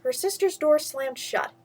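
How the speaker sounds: ordinary delivery, nothing marked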